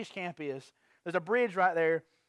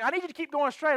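higher-pitched, mildly angry demand; imitating getting mad; 6 semitones higher than usual. Hitchhiker ordering the driver onward, imitated as mad. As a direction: insist crossly, as if retelling. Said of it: "he gets mad".

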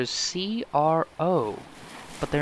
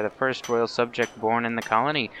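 ordinary talk, nothing marked

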